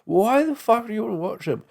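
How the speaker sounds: high exasperated question; incredulous. Retold or imitated. Asking fans of tabloid shows, high exasperation. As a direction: ask it high like it's absurd. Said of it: "I'm like".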